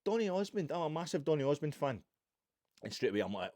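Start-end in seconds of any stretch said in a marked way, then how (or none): 0.0-2.0 high, loud starstruck gushing; a woman thrilled to hear from her idol, gushing loudly; gushing fan. Retold or imitated. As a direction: gush like a superfan who just got a message. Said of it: "she's like".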